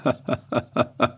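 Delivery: short hearty soldier laugh; a quick laugh at the question; soldier's laugh. A narrator's reading. Soldier laughs at the farmer. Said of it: "Malimis lachte".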